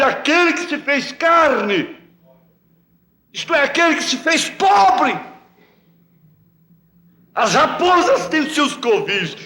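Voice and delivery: high, fervent pulpit preaching; impassioned preacher pleading with his flock; preaching at full force. Acted. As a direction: build the sermon to a passionate lament. Fervent sermon on Christ's poverty.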